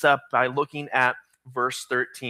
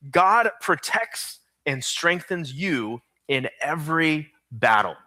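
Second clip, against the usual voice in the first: slow, deliberate dictation pace; slower than usual. Shown as a demonstration. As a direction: spell it out slowly for note-takers. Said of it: "Here's your fill-in".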